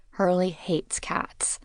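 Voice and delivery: slow whispered aside. A narrator's reading. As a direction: drop to a slow secret whisper.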